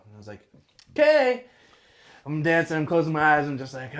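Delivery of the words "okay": excited. Retold or imitated. Sudden cheerful okay.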